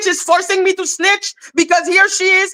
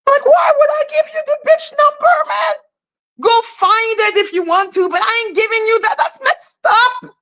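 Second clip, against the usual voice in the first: high, fast, indignant; incredulous outburst; 7 semitones higher than usual. Retold or imitated. Refusing to hand over a number, high and indignant. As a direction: fire back in disbelief. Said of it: "what the fuck".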